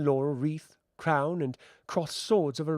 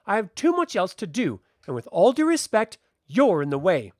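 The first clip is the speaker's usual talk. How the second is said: loud, higher angry tirade; rounding on him angrily; 6 semitones higher, 6 dB louder than usual. Acted. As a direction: tear into him and brush him off. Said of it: "She rounds on him".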